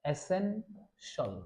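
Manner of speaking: "এসেনশাল" drawn out slowly, ending in shol; model pronunciation. Shown as a demonstration. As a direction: slowly split it, essen... shol. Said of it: "তাহলে আমরা কি উচ্চারণ করব?".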